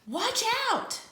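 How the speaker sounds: high, sudden cry of warning; excited warning. Acted. Narrator warning listeners that the cool beans come, high alarmed cry. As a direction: shout it out like a startled kid.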